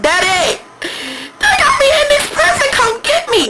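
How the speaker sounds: high, loud, whiny plea to daddy; calling daddy for help. Retold or imitated. Daughter in trouble shouting high to her daddy for rescue.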